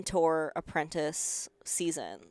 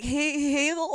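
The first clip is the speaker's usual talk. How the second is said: retold timid repeat; 10 semitones higher, 3 dB louder than usual. Repeating her mispronounced word.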